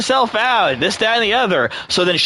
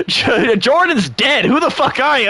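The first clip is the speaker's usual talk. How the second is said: higher-pitched, indignant confrontation; acted angry challenge; 9 semitones higher than usual. Angry ex to the stranger in the car, acted indignant challenge. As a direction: play the furious ex demanding answers.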